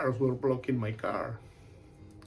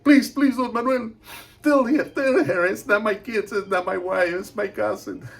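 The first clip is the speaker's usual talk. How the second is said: high, loud frantic begging; scared pleading; 8 semitones higher, 10 dB louder than usual. Retold or imitated. Scared husband begging Don Manuel to calm his wife, frantic pleading. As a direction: beg desperately to be believed. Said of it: "he was very scared".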